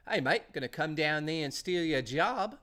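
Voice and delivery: put-on Australian accent, rising at the end. Streamer voicing the Australian guy who replaced a fired viewer, Australian accent. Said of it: "with an Australian guy".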